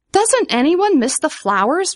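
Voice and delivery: high-pitched pleading cry. A narrator's reading. Vivian asking everyone about flowers, crying out. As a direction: cry out the question in appeal. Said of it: "Vivian cried".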